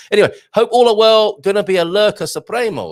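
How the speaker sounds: loud and fast; excited. Real, not acted. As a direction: burst in with energy. Reading chat, burst of energy.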